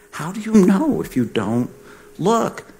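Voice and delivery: rising high on look; rhetorical emphasis. Real, not acted. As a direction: drive the point home. Rhetorical point to audience.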